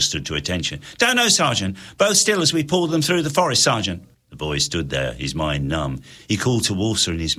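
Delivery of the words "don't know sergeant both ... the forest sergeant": higher, quick soldier reply; nervous soldier. Acted. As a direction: report stiffly to the sergeant. Soldier answering at attention.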